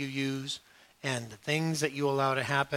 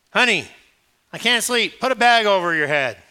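loud, higher mock spouse complaint; nagging spouse; 6 semitones higher, 10 dB louder than usual. Wife telling her glowing husband to cover his head, loud mock. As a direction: toss off the fix like it's obvious.

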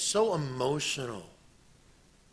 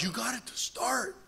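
high, surprised cheer of a dad; dad's delighted surprise; excited dad; 8 semitones higher, 5 dB quieter than usual. Retold or imitated. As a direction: call it out amazed.

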